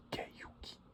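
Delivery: ordinary delivery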